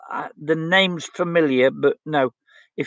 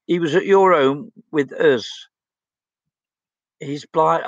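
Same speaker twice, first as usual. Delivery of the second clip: ordinary talk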